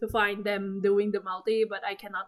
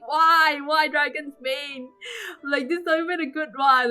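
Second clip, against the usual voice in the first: exasperated, pitched-up complaint; angry whining; 5 semitones higher than usual. Retold or imitated. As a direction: wail in protest at rotten luck. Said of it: "And I'm so angry".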